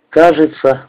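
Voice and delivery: plain reading voice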